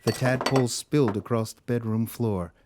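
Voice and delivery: normal talking voice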